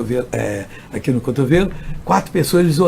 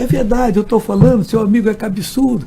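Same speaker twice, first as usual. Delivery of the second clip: raised, higher insisting policeman; insistent; 6 semitones higher, 5 dB louder than usual. Retold or imitated. Policeman insisting to the visiting pastor, raised and high. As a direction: pitch it up and insist the friend is stubborn.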